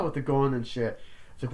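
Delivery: usual speaking voice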